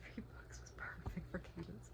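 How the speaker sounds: soft, hushed whisper. Real, not acted. Praising the trilogy to her viewers, whispering. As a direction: breathe it out as a whisper.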